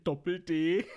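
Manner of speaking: Pointe lachend herausprusten. Retold or imitated. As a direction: kichernd die Pointe bringen. (lachend gesprochen)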